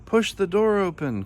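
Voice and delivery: high-pitched urgent call. A narrator's reading. Trapped voice calls to Hippo, high urgent call. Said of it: "called the voice".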